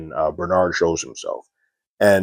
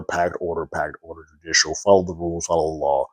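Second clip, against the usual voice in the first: ordinary delivery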